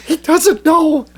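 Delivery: high, drawn-out squeal; high-pitched delighted realization; retold gleeful disbelief. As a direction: squeal it slowly, high up, as if stifling laughter.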